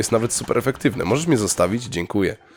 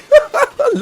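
high, loud shrieking laughter; overjoyed high cackle; gleeful laugh; 7 dB louder than usual. Real, not acted. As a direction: laugh in squealing delight. Laughing after the catch.

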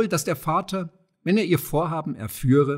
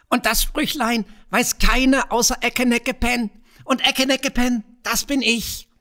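squawking goblin; 11 semitones higher than usual. A narrator's reading. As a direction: crow it out while dancing wildly. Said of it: "mit seiner Quäkstimme".

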